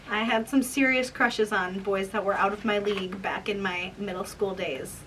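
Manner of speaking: ordinary talk, nothing marked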